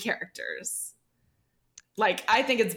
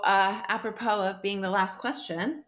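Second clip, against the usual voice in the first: usual speaking voice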